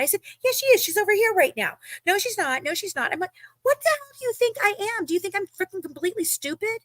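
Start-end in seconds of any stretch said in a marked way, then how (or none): 0.4-1.8 high-pitched retold comeback; insistent contradiction. Acting her own side of argument. 2.0-3.1 high-pitched mimicked denial; insistent denial. Acting Jasmine's denial in retold argument. 3.6-6.8 high-pitched indignant challenge; exasperated retort. Retold or imitated. Retelling exasperated retort in argument.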